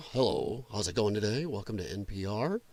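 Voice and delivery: very soft, low NPR host parody; mock golf-outing hush. Streamer mimicking NPR host welcoming listeners, golf-outing hush. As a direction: keep it hushed and low like golf commentary. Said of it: "they talk like they're at a golf outing".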